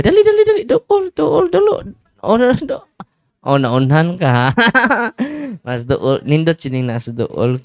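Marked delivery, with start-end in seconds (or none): 0.0-2.8 high, loud rapid calls to hurry up; eager urging. Real, not acted. Priest hurries the children to shout the answer, excited. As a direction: egg them on, high and loud.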